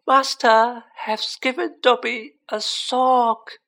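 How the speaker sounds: high, slow, loud elf voice; wondering elf. A narrator's reading. Dobby given a sock by his master, in wonderment. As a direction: stretch each word out in high-pitched amazement. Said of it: "said the elf in wonderment".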